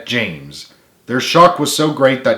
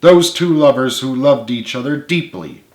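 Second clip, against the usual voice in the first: plain reading voice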